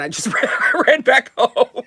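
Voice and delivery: words rising high and loud into a real laugh; breaking into laughter. Real, not acted. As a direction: crack up while telling it. Cracks up at her own story.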